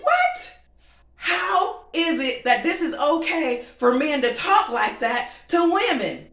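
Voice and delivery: shocked disbelief. Retold or imitated. Responding to the men's crude broadcast comments in disbelief. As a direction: sound outraged and stunned. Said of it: "and I'm like".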